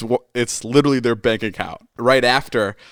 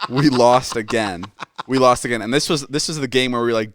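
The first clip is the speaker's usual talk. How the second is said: ordinary delivery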